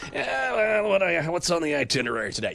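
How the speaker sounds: high, quick busy office Pope voice; Pope at a desk. Pretend. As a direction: play the Pope as a harried office worker.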